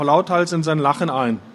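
ordinary delivery

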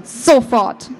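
a short, lightly dark command. A narrator's reading. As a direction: snap it out with a touch of menace. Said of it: "sagte sie mit düsterer Stimme".

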